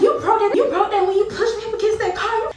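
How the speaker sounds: high, loud, indignant retort; indignant yell. Retold or imitated. Woman yelling back at the man about the mirror, high and loud. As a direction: throw it back in his face. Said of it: "I was like".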